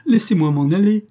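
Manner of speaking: high loud pleading cry; girl crying out to be let go. Acted. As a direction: beg to go home, crying out. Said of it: "criait Proserpine".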